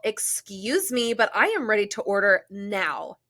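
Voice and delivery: drawn-out "excuse me" as an entitled Karen; Karen impression. Angry little woman calling to the busy server, mock-irate Karen. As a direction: act out an angry customer demanding service. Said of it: "Love your Karen impression".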